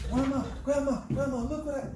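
put-on very high, eager little kid voice; excited grandchild. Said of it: "He's so excited".

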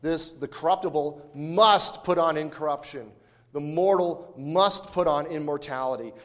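strong, loud stress on "must"; emphasis on must. Real, not acted. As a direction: drive home each "must". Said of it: "I emphasize the word must there".

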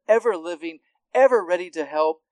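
ordinary talk, nothing marked

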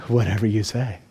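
low, soft easy agreement; massage client yielding, relaxed and low; pliant surrender. Acted. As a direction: give in lazily.

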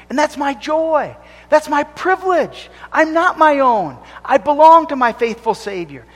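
high, loud, full-force pulpit preaching; fervent preaching. Acted. Preacher urging the congregation to cling to Christ, fervent. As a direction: preach it out with rising fire.

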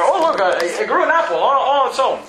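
mock amazement. Mock surprise at broken branch fruiting.

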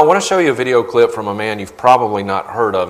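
usual speaking voice

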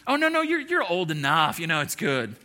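a hammed-up comforting God brushing off worries; God reassuring him. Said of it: "He's not going like".

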